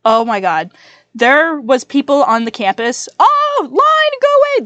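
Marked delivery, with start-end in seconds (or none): 3.2-4.6 high-pitched shoo. Real, not acted. Shooing something off mid-story.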